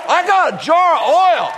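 high, proudly declared answer; hammed-up proud boast. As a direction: show off your one possession. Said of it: "just be really proud".

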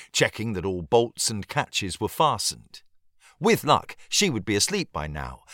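ordinary delivery

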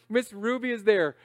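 usual speaking voice

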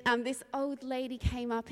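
normal talking voice

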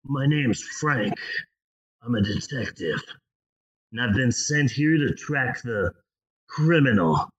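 low, deadpan TV detective; lightly voiced gruff TV cop. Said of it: "that T V cop voice".

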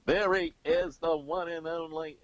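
high, loud announcer's greeting; Howard Cosell. Retold or imitated. Guest imitates Bob Costas slipping back into it, mock announcer voice. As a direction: herald him in a high sportscaster call. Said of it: "he would always talk in Howard Cosell's voice".

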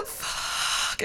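soft, voiceless acted expletive; stunned whispered curse. Alone after sister hangs up, stunned whispered curse. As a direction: let it out as a horrified breath. Shocked reaction after hang-up.

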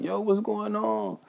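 high, slow alarmed question; worried girlfriend. Retold or imitated. Yvonne seeing the bleeding friend, high slow alarm. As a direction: ask what happened, drawn out and high. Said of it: "She like".

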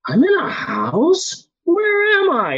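drawn out, a tripping man discovering his own life; slow dazed wonder. Retold or imitated.

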